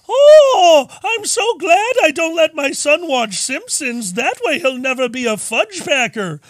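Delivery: high, loud, slow prissy mom; sing-song smug suburban mother; prim mother voice. Acted.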